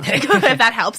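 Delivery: laughing through the words. Real, not acted. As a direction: giggle it out as a joke.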